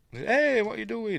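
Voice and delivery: high-pitched imitation of an alarmed character; mock startled call. Girlfriend to man running off, mock startled. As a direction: call after a runaway, hammed-up.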